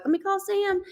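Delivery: quick, high eager offer. Retold or imitated. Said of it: "And I was like".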